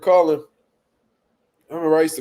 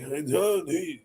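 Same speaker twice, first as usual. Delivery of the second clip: soft put-on voice; soft timid cook voice; mock cook murmur; 6 dB quieter, slower than usual. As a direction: mutter like the quiet cook. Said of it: "sitting there cooking like".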